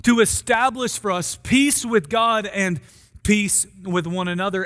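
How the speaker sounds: ordinary delivery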